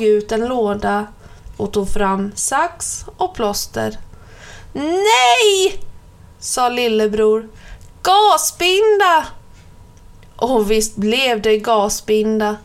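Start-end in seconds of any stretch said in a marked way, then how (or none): 4.7-5.8 put-on high, loud child's cry of no; shrieking no from a small boy; child's protest. Hurt little brother protests. 8.0-9.3 put-on high, loud, slow child's insistence; loud insistent child's cry; child's demand. Hurt little brother demands gauze.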